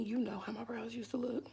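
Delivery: conspiratorial whisper; whispered aside. Real, not acted. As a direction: lean in and whisper it. Aside about old brows.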